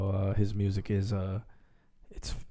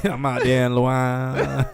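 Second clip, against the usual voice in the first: mock Cajun accent; slower than usual. Mock Louisiana accent bit.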